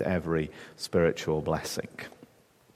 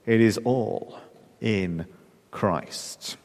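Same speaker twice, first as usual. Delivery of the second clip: It is all, pause, in, pause, Christ, fading softer; dramatic pauses; 4 dB quieter, much slower than usual. Shown as a demonstration. Preacher slowing to land the point, softer to the end. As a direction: space out each word for weight. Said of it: "emphatic pause before Christ".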